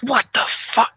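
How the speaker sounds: high, shocked exclamation; startled curse. Retold or imitated. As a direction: yelp it as you slip on the floor. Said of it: "I'm like".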